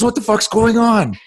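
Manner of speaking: very high, bewildered outburst; high-pitched, exasperated confusion; angry, confused daughter. Retold or imitated. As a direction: imitate a daughter angrily demanding answers. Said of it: "She's angry".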